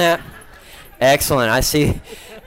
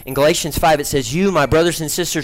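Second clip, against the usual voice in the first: gentle sermon; 3 semitones lower, 3 dB quieter than usual. Acted. Turns the trick into a sermon.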